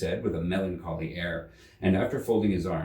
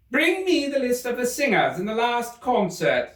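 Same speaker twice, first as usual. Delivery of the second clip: high, commanding royal voice; imperious Queen; 4 dB louder than usual. Acted. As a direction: order the officer about haughtily.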